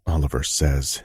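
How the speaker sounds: plain reading voice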